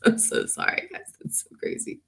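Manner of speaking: breathy laughing, almost voiceless; laughing apology. Real, not acted. To viewers over the date mix-up, laughing. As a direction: giggle the apology under your breath.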